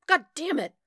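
high, loud burst of frustration; loud, frustrated swearing; exasperated curse. A narrator's reading. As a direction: curse loudly at the mess. Swearing as things keep falling.

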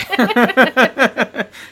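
bursts out in a loud high laugh; big delighted laugh. Real, not acted. Co-host catches the joke.

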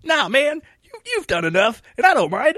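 put-on eager young man. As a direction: play the starry-eyed young host. Young self again.

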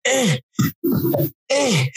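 high, short squeaks of effort; mock straining against an immovable arm; re-enacted futile effort noises; straining grunts. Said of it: "Couldn't bend it".

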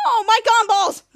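high, loud mock wail; dismayed cry. Ray drops his gumballs, loud dismayed cry. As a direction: cry out over a mishap.

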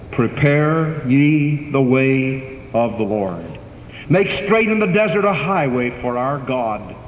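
solemn scripture quote. Acted. Quoting Isaiah chapter forty.

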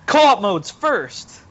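drawn-out, loud joking call; playful shout. Acted. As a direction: shout it slow like a demand. Joking demand for sequel feature.

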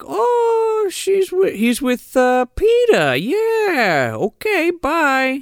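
very high, drawn-out phone voice; high singsong mock phone chat; put-on Molly voice. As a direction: imitate a woman fibbing in falsetto.

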